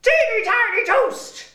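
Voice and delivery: squeaky high-pitched voice; funny high voice. Shown as a demonstration. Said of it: "You have to copy that voice".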